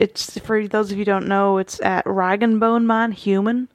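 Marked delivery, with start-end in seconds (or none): none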